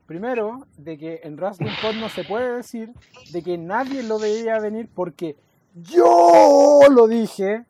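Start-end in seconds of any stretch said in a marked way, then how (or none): 5.7-7.6 "yo" stretched out long and loud; a big drawn-out "yo", then "lo dije" back to normal; emphatic boast. Real, not acted. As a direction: crow it, dragging out a loud "yo". Boasting that he predicted it.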